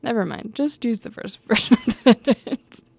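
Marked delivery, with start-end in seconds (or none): 1.1-2.8 real laughter taking over the words; laughing. Real, not acted. Reader giving up on a retake, laughing to the editor. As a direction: break up laughing as you speak.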